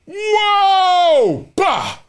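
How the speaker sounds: high, loud cry of alarm; loud high-pitched mock panic; Achilles snapping. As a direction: wail it like something about to snap. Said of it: "And the Achilles said".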